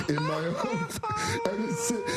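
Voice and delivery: very high, squeaking through laughter; laughing helplessly. Real, not acted. Recalling a fart in a tiny room, words squeezed out by laughter. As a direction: crack up so hard the words squeak. Laughing at own embarrassing story.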